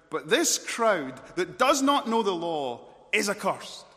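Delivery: slow, loud, scornful condemnation; furious Pharisees. Acted. Pharisees condemn the ignorant crowd, furious. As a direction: pronounce the curse high and loud. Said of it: "the fury here of the".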